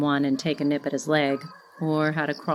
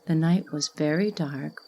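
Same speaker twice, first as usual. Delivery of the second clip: usual speaking voice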